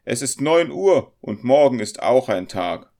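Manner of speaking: high, loud landlady voice; insistent hostess calling time; bossy innkeeper. A narrator's reading. Innkeeper orders guests to bed.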